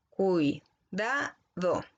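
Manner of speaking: Cuidado said very slowly as cui-da-do; dictating Cuidado syllable by syllable; split into syllables. Shown as a demonstration. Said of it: "no es cuidado, sino que es cuidado".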